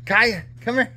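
high-pitched pet call; calling the puppy. Real, not acted.

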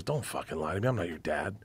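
soft, low imitation of confiding; conspiratorial. Comic coaxes the truth from teenagers, imitated low and conspiratorial. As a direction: keep it down, between us.

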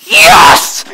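loud, laughing gleeful yes; gleeful cheering. Retold or imitated. Pastor reliving joy at the boyfriend's crash, gleeful shout. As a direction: cheer over his broken car, laughing. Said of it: "I was sitting there going".

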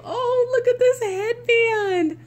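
high-pitched, gushing excitement; real thrilled squeal; delighted squeal. Real, not acted. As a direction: coo high over something adorable. Thrilled unboxing a friend's gift.

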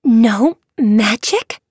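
slow, aghast question; shocked gasp. A narrator's reading. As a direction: gasp it out in dismay. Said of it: "Fabel gasped".

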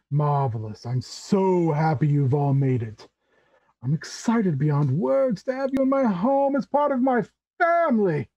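theatrical, gushing host with swooping pitch; effusive Tabaxi uncle. Acted. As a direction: welcome them like long-awaited family.

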